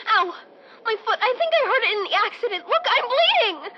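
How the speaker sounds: alarmed at her own bleeding foot; rising pain and panic; pained and panicky. Acted. Injured after accident.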